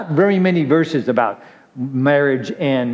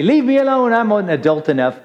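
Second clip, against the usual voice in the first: mock outburst; 7 semitones higher than usual. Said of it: "you get so mad at 'em, you wanna strangle them".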